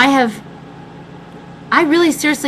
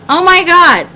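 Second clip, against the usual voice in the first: exasperated exclaim; 10 semitones higher, 7 dB louder than usual. Real, not acted. Exasperated after story.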